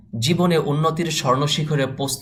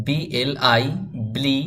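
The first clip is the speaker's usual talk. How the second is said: ordinary talk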